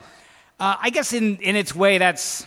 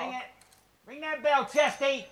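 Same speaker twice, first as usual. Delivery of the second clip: high, softer call for action; high-pitched prompting; 8 semitones higher, 7 dB quieter than usual. Pretend. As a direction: egg them on in a high voice. Calling for the sound effect.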